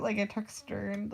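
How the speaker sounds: ordinary talk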